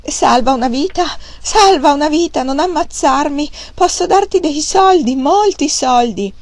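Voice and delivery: high, loud terrified pleading; panicked begging. A narrator's reading. Fat man begging Wang Lung to spare him, terrified. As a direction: beg for your life in a shrill panic. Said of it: "e implorò".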